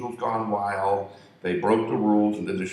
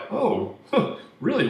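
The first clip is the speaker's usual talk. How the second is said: flattered surprise; 11 semitones higher than usual. Retold or imitated. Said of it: "And he's like".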